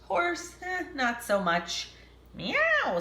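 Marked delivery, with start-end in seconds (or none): 2.3-3.0 high drawn meow; cat meow. Acted. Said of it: "said Cat".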